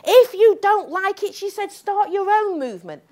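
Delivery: mock indignant, high pitched retort; imitating an angry young organiser; enraged. Speaker quoting the radio organiser's angry retort. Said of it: "She is enraged at me".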